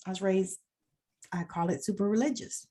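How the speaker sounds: ordinary delivery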